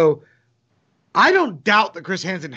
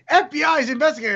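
mock shout; 9 semitones higher than usual. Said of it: "YouTubers keep shouting".

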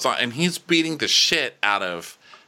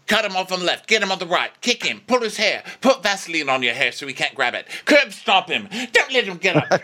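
higher, pushy coach barking orders; mock ruthless coach; 9 semitones higher, faster than usual. Coach urging his pupil on in a hyped-up voice. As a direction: egg him on like a dirty trainer.

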